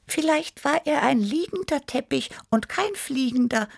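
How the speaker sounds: very high kitten voice, pondering; high-pitched thoughtful kitten; Tibi Tiger. A narrator's reading. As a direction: muse aloud in a tiny high cat voice.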